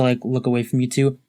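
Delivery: ordinary delivery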